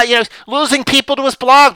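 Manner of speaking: normal talking voice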